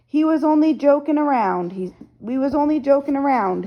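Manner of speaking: high, timid backing-down. A narrator's reading. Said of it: "he said timidly".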